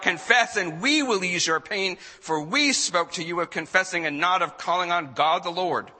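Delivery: loud, high-pitched demand from an interrogator; crying out. A narrator's reading. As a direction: cry it out over the prisoner's prayer. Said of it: "The council cried out".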